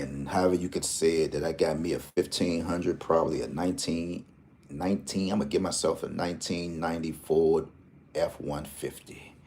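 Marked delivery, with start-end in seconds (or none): none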